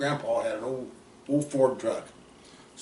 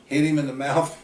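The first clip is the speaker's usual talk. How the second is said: ordinary delivery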